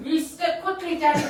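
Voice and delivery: ordinary talk, nothing marked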